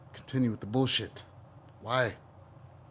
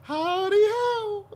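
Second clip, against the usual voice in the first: high, slow cartoon greeting; Mister Hanky; much slower than usual. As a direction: squeak it like a cheery cartoon poo. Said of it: "Mister Hanky".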